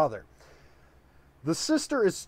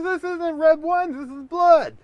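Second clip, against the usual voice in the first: much higher, loud mock indignation; hammed-up high squeal of protest; exasperated correction; 13 semitones higher, 7 dB louder than usual. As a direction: correct her like it's obvious. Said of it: "He goes".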